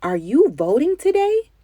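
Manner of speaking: high, loud excited call; shouted question. A narrator's reading. Boy shouting to granddaddy at the polling sign, excited and loud. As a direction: call out across to him, thrilled. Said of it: "shouted to my granddaddy".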